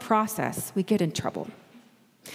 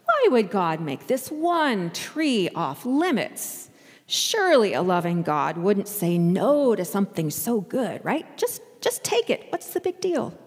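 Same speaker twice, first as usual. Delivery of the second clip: serpent voice. Acted. Preacher voicing the snake in Eden, sly and coaxing. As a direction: tempt like the garden snake, teasing. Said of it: "sounds a little bit like the snake".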